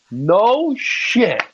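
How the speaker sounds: high-pitched mock amazement; sarcastic disbelief. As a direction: react to the blindingly obvious. Sarcastic reaction to obvious headline.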